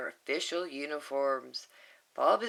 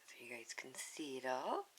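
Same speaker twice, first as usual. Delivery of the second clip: much softer, hushed whisper; whispered aside; 4 semitones lower, 13 dB quieter than usual. Real, not acted. Reader whispering to the kids while showing the page.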